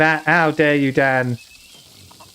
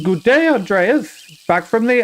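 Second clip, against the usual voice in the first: lightly voiced, higher-pitched villager; trial Klaus voice; 6 semitones higher than usual. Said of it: "What's Klaus gonna sound like?".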